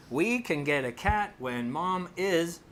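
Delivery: a hint of a child's deliberate pronouncement; imitating a daughter. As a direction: draw it out slowly as the young daughter. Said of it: "he becomes the daughter".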